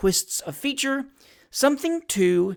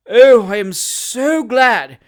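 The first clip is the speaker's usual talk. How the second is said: louder, higher, slow gushing put-on voice; fawning hypocrite; 5 semitones higher, 10 dB louder, slower than usual. Job Trotter gushes false feeling to Sam, fawning voice. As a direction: overdo the heartfelt emotion.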